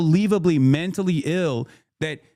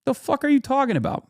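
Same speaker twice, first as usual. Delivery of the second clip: baffled; 9 semitones higher than usual. Retold or imitated. Retelling his baffled comeback.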